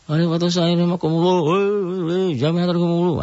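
high, strained shouted greeting; hammed-up shouted greeting; mock loud mosque call. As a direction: bellow it over a loudspeaker. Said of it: "ibadah di masjid teriak-teriak begitu".

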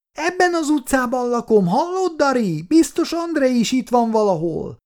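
put-on very high, excited little girl; calling child. A narrator's reading. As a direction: call ahead eagerly to someone behind you. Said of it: "Olenka előrefutott és kiabált". (izgatottan kiabáló kislány)

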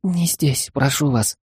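high, hurried scared plea; a young maid babbling in fright; frightened babbling. Acted. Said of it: "испуганно залепетала".

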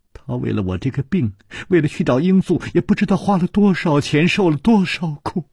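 high, pleading lament for him; mounting distress. A narrator's reading. Liu lamenting her husband's suffering, distressed. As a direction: let sorrow lift the pitch and hurry on. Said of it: "柳无眉黯然道".

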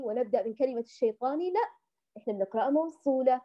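usual speaking voice

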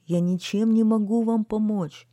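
high plaintive pleading; unhappy. A narrator's reading. Garbage man tells citizens he cannot help, unhappy pleading. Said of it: "сказал несчастный мусорщик".

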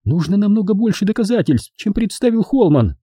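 high, indignant falsetto; high-pitched protest; Edvina flaring up. A narrator's reading. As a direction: object sharply in a high woman's voice. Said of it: "вскинулась Эдвина".

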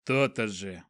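low satisfied grunt; grumble, pleased with yourself. A narrator's reading. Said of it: "удовлетворённо буркнул Элорн".

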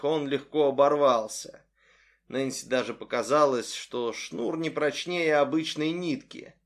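ordinary delivery, nothing marked

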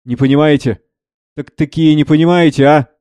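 acted challenge, out in the open; loud. Kondraty refuses Savva's hushed talk, openly challenging. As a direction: say it for all to hear. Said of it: "громко".

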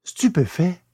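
plain reading voice

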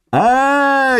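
high ecstatic yell; joyful yell. Acted. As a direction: let out a wild cry of joy. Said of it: "завопил он".

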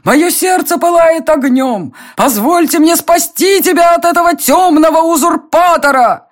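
very high, melodramatic suitor's plea; passionate lover's cry. A narrator's reading. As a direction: declaim it high and grandly, like a hammy serenader. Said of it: "выкрикнул он". (высокая пылкая декламация влюблённого)

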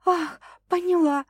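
high gasping female voice; breathless girl. A narrator's reading. Girl giving in to the man, high and gasping. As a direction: gasp it out high.

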